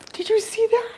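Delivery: excited. Real, not acted. Owl does something beautiful.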